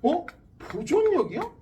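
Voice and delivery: high, rising-and-falling questioning echo; puzzled student. Acted. Student questions teacher about the new term, puzzled and high.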